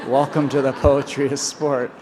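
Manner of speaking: laughing. Retold or imitated. Dad's quote, laughing.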